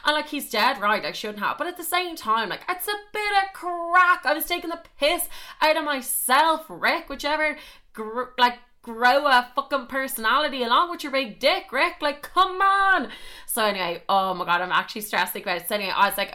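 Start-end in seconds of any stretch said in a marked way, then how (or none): 1.6-13.1 high-pitched, indignant comic tirade; hammed-up outrage, pitched high; exasperated rant. Acted rant at the ex.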